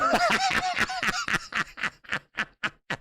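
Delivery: a very high, squealing laugh; real high-pitched laugh at a joke; shrill burst of laughter. Real, not acted. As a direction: break into a squeaky giggle. Reacts to drunk chat comment.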